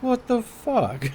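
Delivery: incredulous what the fuck. Retold or imitated. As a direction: drag it out, stunned and outraged. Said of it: "and I was like".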